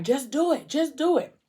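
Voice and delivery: retold yell. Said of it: "they yelling, screaming".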